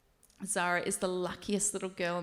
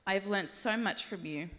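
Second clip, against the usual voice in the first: normal talking voice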